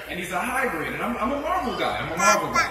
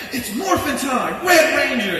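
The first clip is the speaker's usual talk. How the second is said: high, loud, drawn-out battle cry; heroic call-out; 7 semitones higher, 6 dB louder, slower than usual. Acted. Dreamer calling up his Power Ranger form, heroic shout. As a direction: announce it like a transforming superhero.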